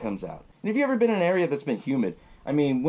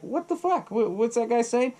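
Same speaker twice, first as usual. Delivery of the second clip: confused tourist; 9 semitones higher than usual. Retold or imitated. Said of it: "everyone's asking Sean".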